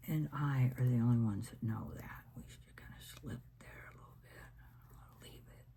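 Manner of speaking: soft breathy whisper to the viewer. Real, not acted. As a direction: lean in and whisper, like sharing a secret. Quiet craft aside whispered.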